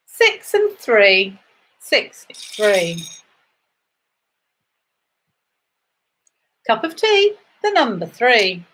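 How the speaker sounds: sing-song number calling, rising then falling; bingo caller. Acted. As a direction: call each number out to the hall.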